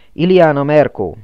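loud call into the room. A narrator's reading. Old man calling into the sickhouse, loud call. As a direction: call her name across the hall. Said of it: "kiáltott be az idős férfi".

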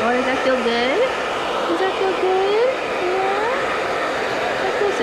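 high, slow cooing to the dog; sweet pet voice. Real, not acted. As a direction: coo over a fluffy puppy. Soothing dog during drying.

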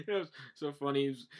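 usual speaking voice

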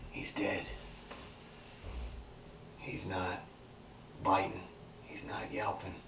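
soft, halting near-whisper; hushed shock. Acted. Boy beside the dog he just killed, hushed. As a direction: let each phrase fall softly into silence. Aftermath of killing his dog.

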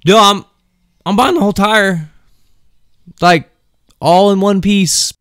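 mock patient sarcasm; sarcastic retort. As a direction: spell out the obvious to him. Own retort in anecdote.

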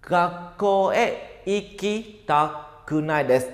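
each syllable laid out slowly; careful teacher pacing for learners; slow model reading. Shown as a demonstration. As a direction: spell the sentence out at half speed. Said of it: "Vậy cả câu nó sẽ là".

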